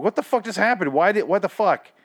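high-pitched, exasperated bafflement; shocked disbelief. Retold or imitated. Host re-enacts his shocked reaction.

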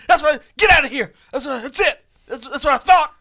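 high, loud mock-tough voice; talking tough. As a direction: brag it off like a scared tough guy. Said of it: "he kinda talks tough as it's running away".

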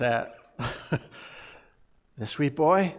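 ordinary delivery

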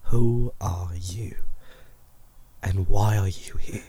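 slow unvoiced whisper. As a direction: breathe the question, wary. Player questions the mysterious voice.

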